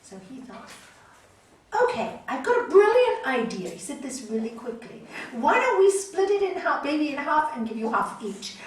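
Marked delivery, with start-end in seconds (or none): 1.8-3.7 sly king. Retold or imitated. King Solomon's sly idea. 5.3-8.6 bold king. Retold or imitated. King proposes splitting baby.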